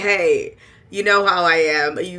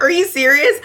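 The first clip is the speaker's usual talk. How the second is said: high, loud disbelieving cry; outraged mock disbelief, much higher; shrill rising are you serious; incredulous; 10 semitones higher, 9 dB louder than usual. Repeat of disbelief, much louder.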